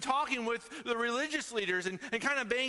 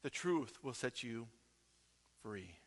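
drops much softer, fading to a hush; softly; 9 semitones lower, 8 dB quieter than usual. Real, not acted. Pastor quoting Jesus to the congregation, fading hush. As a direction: let the last words fall away quietly.

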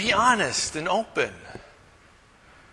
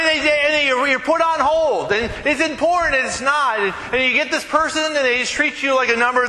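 frustrated rant; 7 semitones higher than usual. Real, not acted. Venting about being on hold.

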